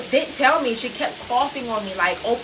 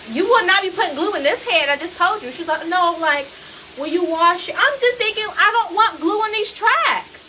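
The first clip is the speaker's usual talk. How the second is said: outraged refusal; 8 semitones higher than usual. Retold or imitated. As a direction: shut down the glue idea firmly. Retold outrage at glue plan.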